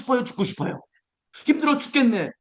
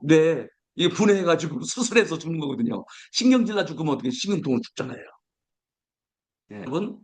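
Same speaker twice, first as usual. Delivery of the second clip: normal talking voice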